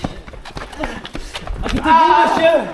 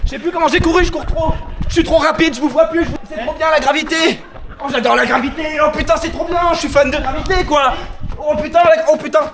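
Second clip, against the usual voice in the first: excited; 4 semitones higher than usual. Real, not acted. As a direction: rave about the speed. Thrilled by game speed.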